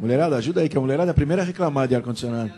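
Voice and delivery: loud, high call across the room. Real, not acted. Calls out to the women present.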